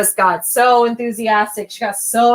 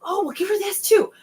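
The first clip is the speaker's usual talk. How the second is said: excited parents; 12 semitones higher, 3 dB quieter than usual. Retold or imitated. Parents voiced eagerly offering food.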